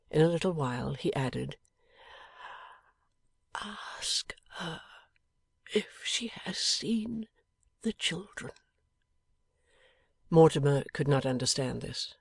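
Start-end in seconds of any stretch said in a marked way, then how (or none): 3.5-8.5 barely voiced, dying breath; a dying man's faint whisper. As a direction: whisper it weakly from a sickbed.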